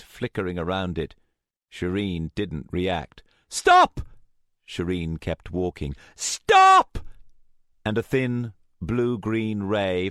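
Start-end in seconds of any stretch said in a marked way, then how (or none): 3.5-4.0 high, very loud yell; piercing desperate command; shout. A narrator's reading. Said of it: "Rachel shouted". 6.1-7.0 long, very loud high scream; last-ditch piercing yell; desperate shout. A narrator's reading. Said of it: "Rachel shouted".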